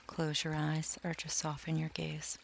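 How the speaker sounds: ordinary delivery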